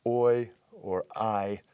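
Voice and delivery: "oi or I" spoken very slowly, each diphthong drawn out; drawn-out demo of "oi or I"; Greek diphthongs oi and ai. Shown as a demonstration. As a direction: slowly sound out "oi" and "I" for the class. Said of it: "the diphthong".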